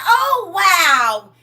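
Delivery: put-on high, loud child's "wow"; amazed grandson. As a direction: gasp it out like a wowed kid. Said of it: "He said".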